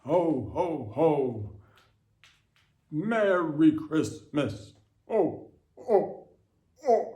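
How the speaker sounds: belly-laugh it like Santa; hammed-up Santa greeting; Santa Claus. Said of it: "what did Santa Claus say?".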